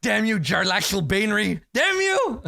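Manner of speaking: high, loud theatrical curse; mock cursing. Game master cursing a rival drow, mock outrage. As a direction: curse the rival in hammed-up outrage, rising on the repeat.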